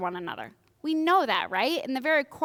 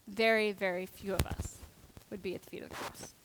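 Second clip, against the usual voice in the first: whispered, much softer, fast; solemn whisper; 3 semitones lower, 9 dB quieter than usual. Real, not acted. Preacher ends on who stays at the cross, whispered. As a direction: let it fall to a hush.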